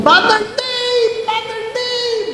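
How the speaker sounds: high, slow, drawn-out call from afar. Retold or imitated. Prisoner calling to the priest, high drawn-out call. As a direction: call the name long and high across a yard. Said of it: "may sumigaw".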